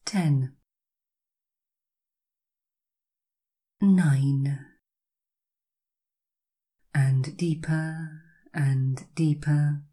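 slow hypnotic counting down; slow soothing countdown. Acted. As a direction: count each number slowly and calmly. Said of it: "you will hear me count down from ten".

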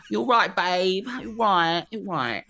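high, loud mock-British reassurance; bad British accent. Joking as [name] in the UK, bad British accent. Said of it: "like [name] when he arrives to the U K".

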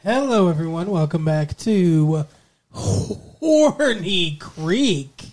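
lightly hammed podcast host welcome; host intro voice. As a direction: greet the listeners like a show host.

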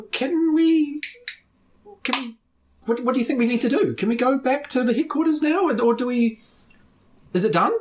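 high, fast, anxious questions; nervous Poffman. Acted. Scared priest asking his friends what next, high and hurried. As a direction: fret about what to do next.

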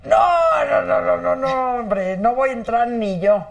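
mock-emphatic refusal, half laughing it off; retold refusal. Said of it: "Y me dice".

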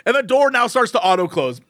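ordinary talk

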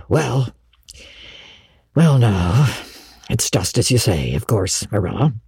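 low, sighing surrender; resigned. Acted. Matthew yielding to Marilla, resigned. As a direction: give in haltingly, dropping low.

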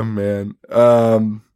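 usual speaking voice

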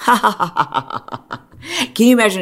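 asks it again through a laugh; laughing disbelief. Shown as a demonstration. To viewers about the double standard, laughing. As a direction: laugh as you repeat the question. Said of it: "repeated question".

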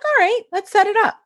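high, bright agreeing voice; lightly voiced Sally. Sally agreeing to a session, high mock voice. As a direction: lightly play the teacher saying yes. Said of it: "She's like".

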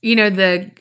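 ordinary talk

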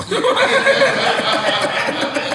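laughing in high bursts; high cackling laugh. Real, not acted. Big laugh.

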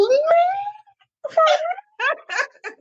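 high, amazed "me, for real?"; surprised disbelief. Retold or imitated. Host retelling her stunned reaction to guests, high and amazed. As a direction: act out being stunned by the compliment.

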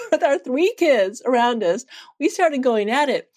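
usual speaking voice

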